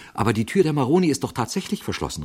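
quick, high-pitched protest; astonished. A narrator's reading. Jarvis objects to Father Brown about Maroni's door, astonished. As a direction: blurt it out in disbelief. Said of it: "rief Jarvis erstaunt".

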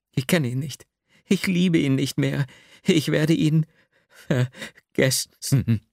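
mildly heated refusal slowing to a halt; insist, then falter on the final word; overwrought, faltering. A narrator's reading. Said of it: "Sie kam nicht weiter". (aufgewühlt, am Ende stockend)

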